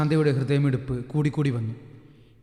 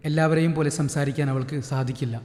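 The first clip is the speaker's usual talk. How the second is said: plain reading voice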